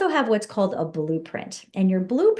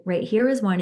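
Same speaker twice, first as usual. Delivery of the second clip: ordinary talk, nothing marked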